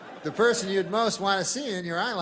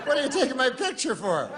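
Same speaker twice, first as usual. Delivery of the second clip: cheery Janice; 6 semitones higher than usual. Pretend. Janice imagined answering.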